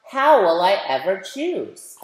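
plain reading voice

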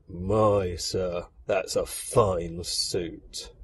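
low, subdued compliment; soldiers' muted admiration; dutiful soldiers' praise. Acted. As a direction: praise it low and soft, drawing out the end. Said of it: "foot soldiers are all saying".

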